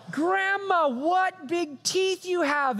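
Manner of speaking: hammed-up innocent little girl. Girl voiced in the story.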